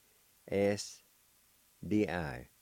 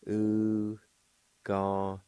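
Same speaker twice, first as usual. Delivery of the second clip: ordinary delivery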